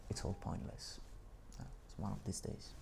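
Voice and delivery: whispered, defeated aside to himself; dejected mumbling. Real, not acted. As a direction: mutter it under your breath, giving up. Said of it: "enough mumbling".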